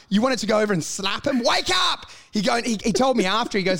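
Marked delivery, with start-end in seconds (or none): 1.4-2.1 high-pitched exasperated yell; sharply raised comic shout; mock shout. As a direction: yell to jolt someone awake. Retold shout to rouse interviewer.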